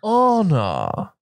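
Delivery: honor with a dropped r, said high and posh like ɑnə; posh British pronunciation of Honor. Shown as a demonstration. A Brit showing a co-host how posh people say honor. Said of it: "really posh".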